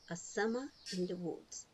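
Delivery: ordinary delivery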